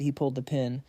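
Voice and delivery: ordinary delivery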